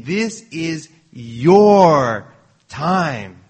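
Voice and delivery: very long, loud "your", then a pause; stress on YOUR. Acted. Preacher paraphrases the angel to Gideon, heavy stress on "your". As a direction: draw out "your" hard before "time". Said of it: "he was emphasizing this word".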